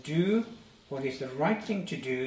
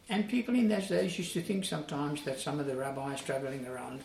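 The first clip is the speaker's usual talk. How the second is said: normal talking voice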